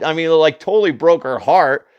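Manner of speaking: usual speaking voice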